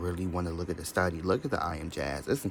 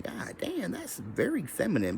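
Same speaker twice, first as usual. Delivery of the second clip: mock shocked squeal; 10 semitones higher, slower than usual. Mock shock at own jiggle.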